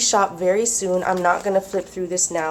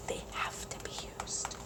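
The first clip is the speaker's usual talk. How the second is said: much softer whispered vow; whispered resolve; 18 dB quieter than usual. Real, not acted. Vlogger persuading herself to use paper, soft whisper. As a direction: whisper it like a promise to yourself.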